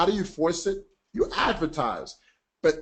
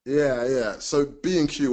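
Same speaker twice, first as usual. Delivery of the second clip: ordinary talk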